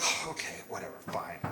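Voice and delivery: very soft, dropping sulk; grudging surrender. Acted. Mother-in-law refused the open window, sulky. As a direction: give in under your breath.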